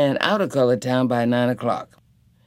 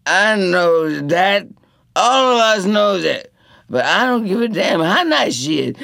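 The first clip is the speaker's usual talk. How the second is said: put-on loud, angry drunk; drunken defiance; 6 semitones higher, 3 dB louder than usual. A narrator's reading. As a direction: challenge him to a fight. Cootie May arguing drunk.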